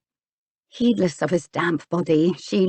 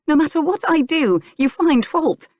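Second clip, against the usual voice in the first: high-pitched, fed-up protest; narrated indignant outburst; exasperated complaint; 10 semitones higher, 4 dB louder than usual. A narrator's reading. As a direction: throw the blame back at him. Acted quarrel, she complains of his grumpiness.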